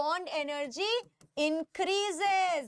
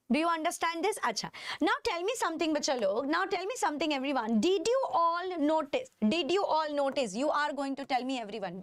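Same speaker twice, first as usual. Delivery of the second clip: ordinary delivery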